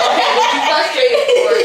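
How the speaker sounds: giggling imitation of a teasing challenge; laughing mock question. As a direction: tease him with a laugh. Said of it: "And I'm like".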